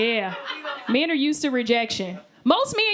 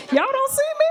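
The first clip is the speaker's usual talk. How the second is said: high-pitched, mock-offended question; rising, put-out protest to be noticed; indignant disbelief; 13 semitones higher than usual. Woman to construction workers who ignore her, mock-offended.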